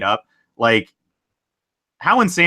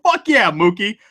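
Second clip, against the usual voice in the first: sudden higher-pitched celebratory yell; excited yell; 7 semitones higher than usual. Retold or imitated. Host reads a fan's mid-sex cheer for Mookie's catch, excited yell. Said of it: "and I yell".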